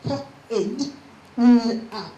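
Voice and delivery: kidnap broken up as k, id, n, ap; k, id, n, ap as four isolated chunks; onsets and rimes separated. Shown as a demonstration. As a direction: sound out k, id, n, ap with gaps. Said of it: "two onsets and two rhymes".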